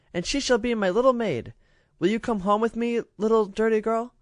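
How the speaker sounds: put-on high, grand little girl's voice; spoiled princess. A narrator's reading. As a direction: condescend to the waif. Princess invites child.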